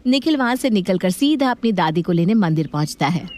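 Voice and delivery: usual speaking voice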